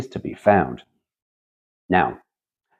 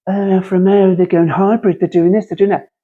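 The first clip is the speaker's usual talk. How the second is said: higher, louder imitation of gushing people; hammed-up breathless buzz about Alfa going hybrid; mock excited punters; 9 semitones higher, 5 dB louder than usual. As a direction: parrot the hype like an excited crowd. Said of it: "And people go".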